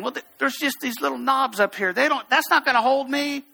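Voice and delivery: high, imitated frightened climber; panicky protest. Scared student doubting little knobs, mock panicky protest.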